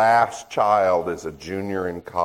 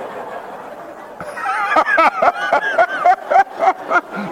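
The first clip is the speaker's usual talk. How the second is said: real burst of high laughter, louder; 4 dB louder than usual. Real, not acted. Speaker to silent crowd, high laughing burst. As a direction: crack up at his own joke. Audience fails to laugh at joke.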